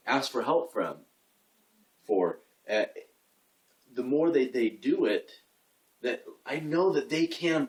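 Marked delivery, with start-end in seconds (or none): none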